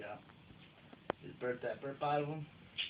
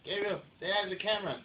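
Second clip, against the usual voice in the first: calling out; 6 semitones higher, 7 dB louder than usual. Real, not acted. Telling child off camera.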